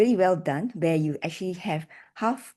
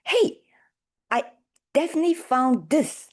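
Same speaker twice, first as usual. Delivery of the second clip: higher-pitched excited demo line; high part; 7 semitones higher, 3 dB louder than usual. Shown as a demonstration. Evaluator showing speaker the high part, pitched up excitedly. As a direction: lift the pitch up high on an eager call. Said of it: "high part is when you say".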